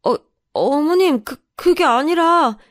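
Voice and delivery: slow, high, faltering excuse; flustered stammer. Acted. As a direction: stammer nervously as if caught out. Caught by mother-in-law, stammers excuse.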